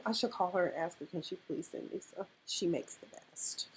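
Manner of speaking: soft fast aside fading to a whisper; whispered aside to best friend; quiet aside. Real, not acted. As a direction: drop into a whisper. Aside about crawfish mac.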